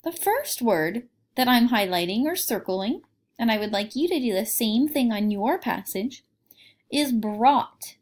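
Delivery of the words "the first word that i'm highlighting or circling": ordinary delivery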